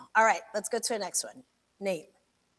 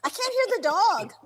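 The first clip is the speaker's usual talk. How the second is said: raised, higher voice; loud complaint; 8 semitones higher, 3 dB louder than usual. Real, not acted. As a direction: call it out louder. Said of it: "You gotta do it louder".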